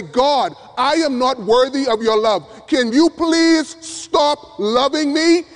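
a high, stretched-out plea from an unworthy sinner; pleading prayer. Pretend. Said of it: "bow your knees right now and say".

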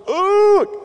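high, loud groan of disgust at the smell; disgusted group ooh. Retold or imitated. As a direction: react to the stink. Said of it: "we're all going".

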